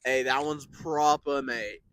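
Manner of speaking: slow British drawl, slipping Australian; mock British accent. Fooling with Brits in store, drawled British accent. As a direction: draw it out in shaky British-Australian. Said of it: "You sound like you're more Australian".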